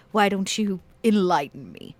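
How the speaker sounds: ordinary delivery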